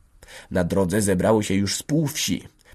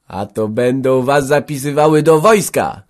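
rising, louder taunt; screeching jeer; 6 semitones higher, 8 dB louder than usual. A narrator's reading. Village woman teasing the huddled women, screechy jeer. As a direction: jeer it higher and louder at the end. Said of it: "zaśmiała się skrzekliwie".

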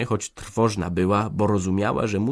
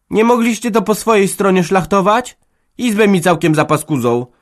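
flustered outburst; 12 semitones higher, 9 dB louder than usual. Acted. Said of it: "wykrztusiła w pąsach".

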